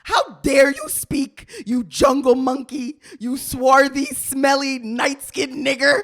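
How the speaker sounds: loud mock Indian accent, slow.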